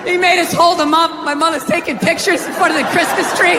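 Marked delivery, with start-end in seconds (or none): none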